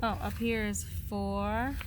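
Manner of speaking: usual speaking voice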